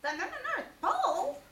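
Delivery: high, fast frightened stutter; stammering Piglet. Acted. Piglet startled by the North Pole, high stutter. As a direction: stammer it out in alarm. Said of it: "cried Piglet".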